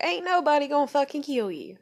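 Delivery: loud, high retold dismissal; exasperated contradiction. Girlfriend to panicking boyfriend on the phone, exasperated.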